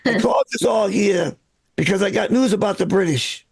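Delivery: put-on a light Boston townie accent running a rowdy meeting; gruff Boston regular calling the meeting to order, only a hint of accent. Boston townie chairing the Sons of Liberty meeting, light Boston accent. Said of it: "people in Boston spoke with the same accent they have now".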